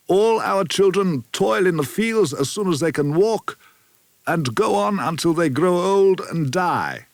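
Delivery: lightly voiced old man, solemn and bitter; a hint of an old village elder warning of the lords; old rebel elder Kalmar. Kalmar continues, slower.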